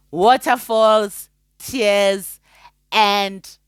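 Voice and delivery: slow mock drama. Real, not acted. Mocking tears.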